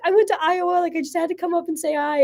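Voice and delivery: high, eager, nervous introduction. Retold or imitated. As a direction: blurt out why you came over. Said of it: "went up very nervous".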